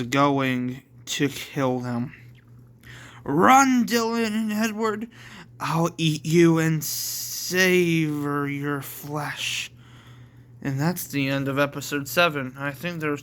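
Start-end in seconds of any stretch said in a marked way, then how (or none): 3.2-9.7 menacing devil. Acted. Queen Devil hunts Dylan and Edward, menacing. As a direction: taunt them as prey, drawing out the end. Villain threatens fleeing prey.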